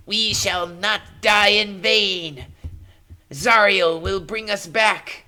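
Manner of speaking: fanatic cultist. Acted. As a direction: proclaim it like a doomed true believer. Cultist in the corner speaks defiantly.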